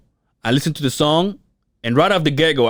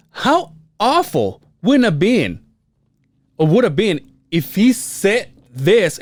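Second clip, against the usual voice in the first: high, slow mock dismay; imagining the guy at the bar, high and slow; mock horror; 7 semitones higher, slower than usual. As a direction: stretch it out in fake horror. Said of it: "So I go".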